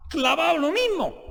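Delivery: high rhetorical outburst; emphatic exclamation. Real, not acted. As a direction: hammer it home on a high, stretched note. Rhetorical jab at rival party.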